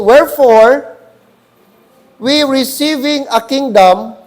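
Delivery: slow, loud, weighty reading of the verse; solemn scripture reading. Acted. Pastor reading Hebrews aloud to congregation, slow and solemn. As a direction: read it like sacred text, every word weighed. Preacher reads verse with gravity.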